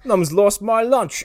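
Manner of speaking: loud, high mock Australian accent; put-on Australian accent. Co-host echoing Kano's jeer, exaggerated Australian accent. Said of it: "losing my Australian accent".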